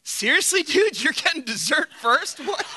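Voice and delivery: high, incredulous teasing; high mocking disbelief; mock shaming. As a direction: rib him for the dessert in disbelief. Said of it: "What I did instead was go".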